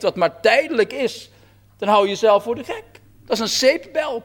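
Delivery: normal talking voice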